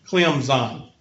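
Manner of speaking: "Clemson" as "Clem-zine", with no P; announcer's Clemson. Shown as a demonstration. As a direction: mimic a sports announcer saying "Clemson" with a Z. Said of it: "say it like the E S P N announcers".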